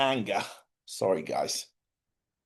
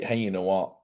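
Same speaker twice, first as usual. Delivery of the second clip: softened apology; 4 semitones lower, 6 dB quieter than usual. Retold or imitated. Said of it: "And they'll say".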